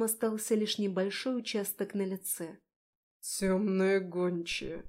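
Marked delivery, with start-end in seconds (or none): 3.2-4.8 slow, hissed dragon voice; hissing dragon. A narrator's reading. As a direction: hiss the name like a dragon. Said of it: "прошипел дракон". (шипящий голос дракона)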